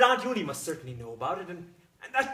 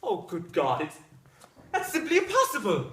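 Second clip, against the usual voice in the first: aghast outcry; 6 dB louder than usual. Acted. Husband cannot believe his wife burnt it, aghast outcry. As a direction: exclaim in horror.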